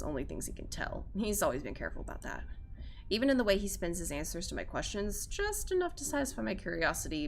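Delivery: ordinary talk, nothing marked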